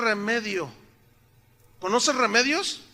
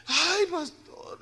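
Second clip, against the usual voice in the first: put-on high, drawn-out old-lady sigh of words; elderly church sister; 10 semitones higher, much slower than usual. Ninety-year-old sister answers her pastor, imitated old-lady lament. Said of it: "no sé, noventa años".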